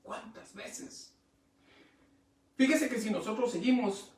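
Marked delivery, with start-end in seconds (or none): 0.0-1.2 slow breathy whisper. Acted. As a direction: breathe the question out slowly. Pregunta tras el clamor. (susurro lento)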